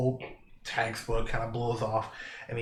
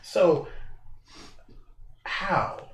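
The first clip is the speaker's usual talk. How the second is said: higher-pitched, probing question with a pause; quizzical; 6 semitones higher than usual. Acted. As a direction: ask it as a puzzled challenge. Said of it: "this kinda quizzitive thought".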